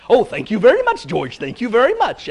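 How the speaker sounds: lightly voiced dog, quick and thankful; a hint of a dim dog gushing thanks; dog thanks the disguised rabbit, fast and lightly voiced; grateful cartoon dog. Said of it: "the dog comes up and says".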